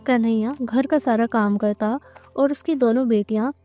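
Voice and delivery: ordinary delivery, nothing marked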